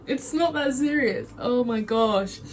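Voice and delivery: ordinary talk, nothing marked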